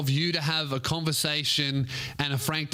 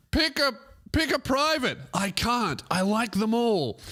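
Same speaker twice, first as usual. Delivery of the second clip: mock high voice; 8 semitones higher than usual. Acting Edmund's reply.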